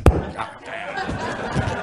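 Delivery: unvoiced grumbling curse; muttered curse. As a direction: grumble it under your breath.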